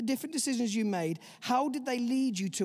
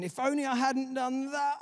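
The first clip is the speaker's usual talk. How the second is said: higher, anguished cry of regret; hammed-up lament of shame; 6 semitones higher, 3 dB louder than usual. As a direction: let the regret build and break. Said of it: "It energizes regret".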